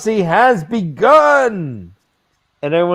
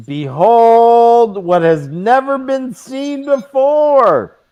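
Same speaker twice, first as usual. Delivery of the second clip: yelling; 5 semitones higher, 4 dB louder, slower than usual. A narrator's reading.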